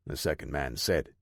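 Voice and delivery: usual speaking voice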